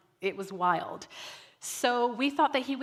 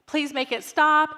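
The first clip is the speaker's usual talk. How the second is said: put-on higher, louder pleading kid; retold plea; 6 semitones higher, 7 dB louder than usual. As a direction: imitate a child appealing to the teacher. Child pleading to teacher.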